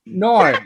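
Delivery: high, exaggerated Australian "no"; hammed-up "no" with an Australian glide; demonstrated Aussie "no". Shown as a demonstration. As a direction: bend the vowel in "no" like a broad Aussie. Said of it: "the sound you're trying to conquer".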